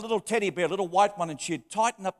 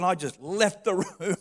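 ordinary talk, nothing marked

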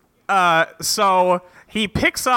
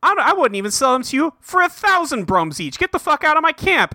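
rising higher into an angry yell; angry refusal; 7 semitones higher than usual. Retold or imitated. Sadeas throws Dalinar out of his camp, mock angry. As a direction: Sadeas snapping at him, climbing higher.

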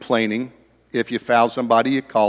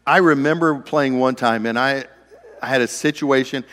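usual speaking voice

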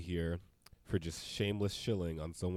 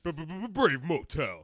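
high, slow stuttered radio sting; morning zoo DJ; 9 semitones higher, much slower than usual. Pretend. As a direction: hype it like a zoo crew DJ. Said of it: "morning Zoo Crew intro".

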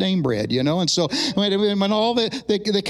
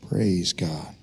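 normal talking voice

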